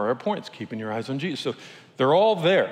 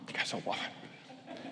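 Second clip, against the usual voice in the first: susurro casi sin voz, mucho más bajo; hushed aside; 7 semitones lower, 18 dB quieter than usual. Retold or imitated. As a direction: lean in and breathe it as a secret. Whispered aside while retelling rafting chat.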